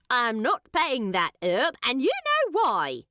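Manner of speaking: lightly voiced market hobbit, stubborn; grumbling hobbit. A market hobbit refuses to pay another hobbit, indignant. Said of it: "said the hobbit nearest Thorin".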